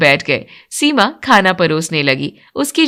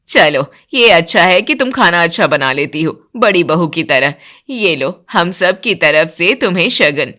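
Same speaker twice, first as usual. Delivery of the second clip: strict mother-in-law; 5 semitones lower than usual. Acted. Mother-in-law laying down house rules to new bride, stern lower voice. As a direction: lay down the rule like a stern matriarch.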